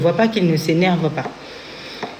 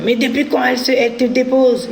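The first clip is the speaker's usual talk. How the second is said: high, sharp angry challenge; retold outburst, pitched high; angry ex-husband; 7 semitones higher than usual. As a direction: mimic the husband snapping at the child. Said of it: "il s'énerve contre l'enfant".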